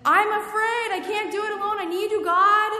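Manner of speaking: high, loud pleading prayer; desperate plea. Acted. Believer crying out to God in fear, high and loud. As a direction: cry out to God, afraid and alone.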